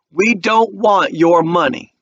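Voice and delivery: very slow, every word set down; slow firm refusal. A narrator's reading. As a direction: hold his eye and refuse. Walter refusing the money.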